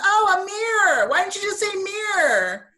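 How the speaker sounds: sudden realization. Retold or imitated. Student finally understands the word.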